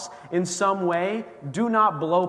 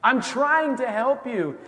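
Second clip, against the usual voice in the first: higher, slow, pleading exasperation; exasperated God; 8 semitones higher, 6 dB louder, slower than usual. Retold or imitated. God pleading with the man on the roof, slow and higher. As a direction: spell it out slowly to a stubborn child. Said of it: "And God's like".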